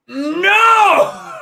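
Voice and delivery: a loud, high cry of disbelief; shocked shout. Retold or imitated. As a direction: yell it as if seeing the gun on screen. Reacting as the gun is revealed.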